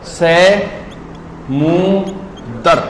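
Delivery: से मु दर broken into separate syllables with pauses; wrong word spelled out in syllables. Shown as a demonstration. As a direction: chop से मु दर into slow beats. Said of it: "इसे गलत बोलते हैं".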